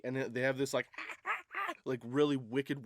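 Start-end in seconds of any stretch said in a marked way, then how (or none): 0.9-1.8 very high imitated chimp shrieks; repeated mock monkey screeches, not loud. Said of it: "weird, loud screech".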